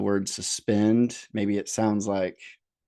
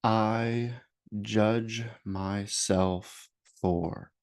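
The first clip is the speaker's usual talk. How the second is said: slow meditation voice with long pauses; guided meditation. Acted. Therapist leading listeners to name self-judgments, slow guided meditation. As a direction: guide them unhurriedly, leaving space after each phrase.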